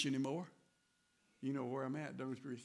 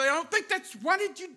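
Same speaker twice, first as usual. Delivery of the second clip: high, loud, rising to a shout; arguing with God; 13 semitones higher, 16 dB louder than usual. Retold or imitated. Preacher shouting his frustration at God, loud and high. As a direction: plead the case like a furious argument. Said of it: "and I say".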